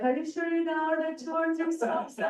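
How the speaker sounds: high-pitched doubting hostess imitation; imitated disbelief. Hostess questioning a big ice cream order, mimicked disbelief.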